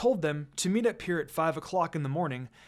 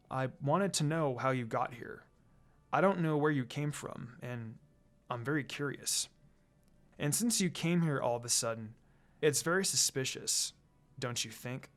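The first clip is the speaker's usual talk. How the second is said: softer, low, probing questions; curiously; 4 semitones lower, 6 dB quieter than usual. A narrator's reading. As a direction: quietly press her with suspicion. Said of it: "Sasuke said very curiously".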